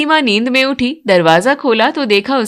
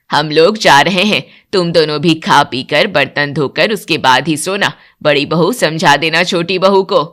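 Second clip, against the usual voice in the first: strict mother-in-law; 6 semitones lower than usual. Acted. Mother-in-law laying down house rules to new bride, stern lower voice. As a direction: lay down the rule like a stern matriarch.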